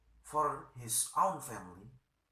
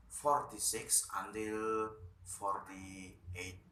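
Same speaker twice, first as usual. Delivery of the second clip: ordinary delivery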